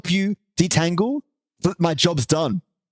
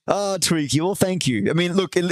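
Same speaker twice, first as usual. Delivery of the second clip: ordinary talk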